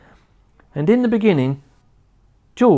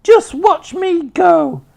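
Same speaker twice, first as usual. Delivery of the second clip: very high, very loud screechy crone whoop; wild old woman's shout; 5 dB louder, slower than usual. A narrator's reading. Grandma rising through the floor, screeching with glee. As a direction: whoop with mad joy.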